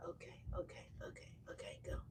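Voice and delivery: soft whispered okays to settle the cat; hushed whisper. Real, not acted. Talking to her cat, whispering okay to calm it. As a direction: breathe the okays out with no voice.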